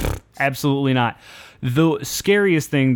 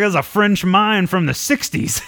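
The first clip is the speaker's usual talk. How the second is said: imitating dad; 6 semitones higher than usual. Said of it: "He's like".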